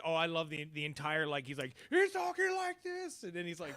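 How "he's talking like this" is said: high, drawn-out crazy voice; squeaky-high mock crazy voice; sounding crazy. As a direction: imitate him talking crazy, pitched way up and slow. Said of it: "sounding crazy".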